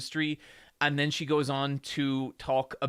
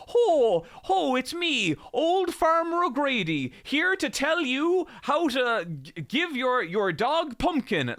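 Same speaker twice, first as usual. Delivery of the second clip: hammed-up fake Irish farmer voice, much higher and louder; Irish accent done badly on purpose, high and loud; mock stage Irish; 11 semitones higher, 4 dB louder than usual. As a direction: play a cartoon Irish farmer the way Americans imagine one. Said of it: "me doing an impression of Americans doing an impression of Irish people".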